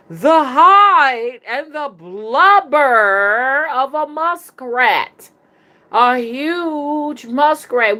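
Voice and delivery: high, loud, hugely stretched words; hammed-up horror, slow and high; dramatic reveal. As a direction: draw out each gory word for shock.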